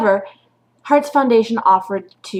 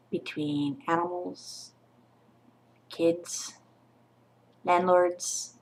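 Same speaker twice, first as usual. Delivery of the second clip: much softer and slow, worn out; drained and quiet; 3 semitones lower, 10 dB quieter, slower than usual. Real, not acted. Mother sums up her awful month, spent and quiet. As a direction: let it all drain out of you. Exhausted after the rant.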